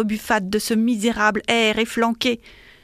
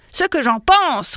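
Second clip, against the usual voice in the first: loud, drawn-out, higher roar; bellowed like an angry clerk; 6 semitones higher, 6 dB louder than usual. A narrator's reading. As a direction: roar it with indignation. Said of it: "rugit Dindonneau".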